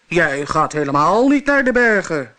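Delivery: loud monster roar. Acted. Monster roars at the little goat, loud. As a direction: bellow it at the goat. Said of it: "brulde het monster".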